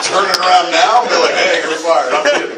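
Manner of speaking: loud and high, laughing. Real, not acted. Laughing while joking about firing someone. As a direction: laugh through the mock firing.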